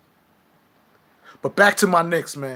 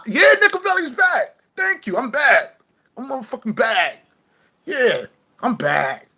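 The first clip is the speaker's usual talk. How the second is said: excited comeback; 8 semitones higher, 3 dB louder than usual. Real, not acted. Triumphant return announcement.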